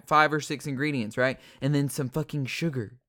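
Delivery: normal talking voice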